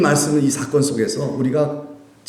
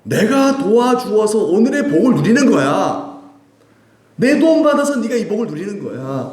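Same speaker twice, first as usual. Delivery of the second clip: voicing God; 8 semitones higher than usual. God speaking to Israel.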